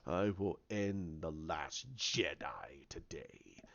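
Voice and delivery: deep, slow, half-whispered villain rasp; Snoke's voice. Retold or imitated. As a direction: loom over him, low and menacing, breathing the words. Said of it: "He goes".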